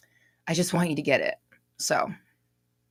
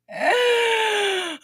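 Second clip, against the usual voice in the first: shrieking laugh; 4 dB louder than usual. Real, not acted. Excited greeting of viewers.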